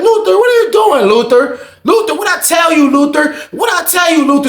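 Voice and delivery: officer scolding an inmate, alarmed; shrill scolding. Retold or imitated. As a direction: scold him, rising panic. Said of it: "She like".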